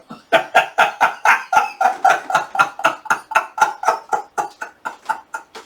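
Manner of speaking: long breathless wheeze of laughter; real, drawn-out silent cackle; wheezing laugh. Real, not acted. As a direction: laugh helplessly without voice.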